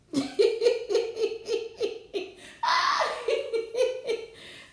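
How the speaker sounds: long airy laugh. Real, not acted. Laughing with chat.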